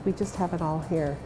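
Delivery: ordinary delivery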